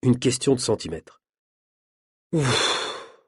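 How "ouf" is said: soft, breath-out sigh of relief; relieved sigh. A narrator's reading. Jackie sees the performer is safe, relieved sigh. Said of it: "soupire Jackie".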